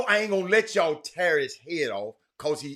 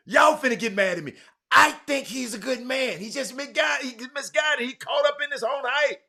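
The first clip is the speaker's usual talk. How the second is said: raised ranting voice; 7 semitones higher, 5 dB louder than usual. Real, not acted. Host rants on air about rival.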